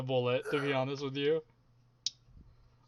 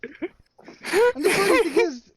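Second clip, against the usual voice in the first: excited squeal; 8 dB louder, slower than usual. Real, not acted.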